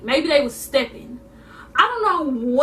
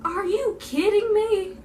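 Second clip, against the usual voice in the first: higher, incredulous, then a laugh; hearing every song through the ceiling, disbelief; 7 semitones higher than usual. Retold or imitated. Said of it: "And I'm just like".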